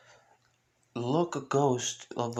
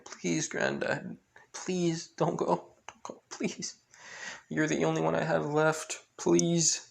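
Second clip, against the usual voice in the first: grandchild pleading; 5 semitones higher than usual. Acted. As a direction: plead in a small, high voice. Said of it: "with his grandchild saying".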